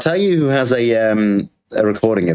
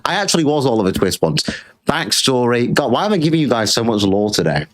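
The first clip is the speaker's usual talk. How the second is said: ordinary talk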